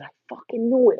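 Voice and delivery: excited exclaim. Retold or imitated. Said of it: "And I said".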